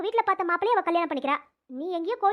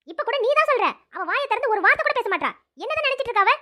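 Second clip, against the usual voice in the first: louder and higher, sharp reprimand; angry scolding; 4 semitones higher, 4 dB louder than usual. Acted. Teacher to silent student at camp, angry scolding. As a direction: scold her for keeping silent.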